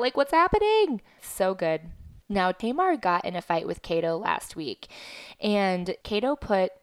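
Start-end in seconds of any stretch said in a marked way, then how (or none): 0.0-1.0 sudden high, loud cry of disbelief; frantic outburst. Retold or imitated. Addicted fan demanding answers from the show, high loud squeal. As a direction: throw up your hands, squealing what's happening. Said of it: "I'm like".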